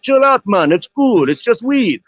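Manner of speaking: a mock Jamaican stoner telling someone to relax; bad Jamaican accent. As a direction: lay on a clumsy Jamaican accent, chilled out. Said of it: "fucking [name] accent".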